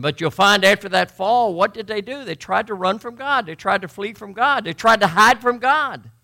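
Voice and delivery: loud, high, driving sermon delivery; preaching at full force. Real, not acted. Preacher telling of the fall, impassioned full-force preaching. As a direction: build each repeated phrase to a forceful peak.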